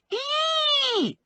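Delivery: very high, held squeal; shriek. A narrator's reading. Little man reacting to the handcuff, high-pitched shriek. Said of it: "Briggs shrieked".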